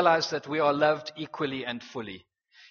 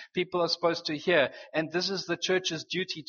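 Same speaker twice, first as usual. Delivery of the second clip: usual speaking voice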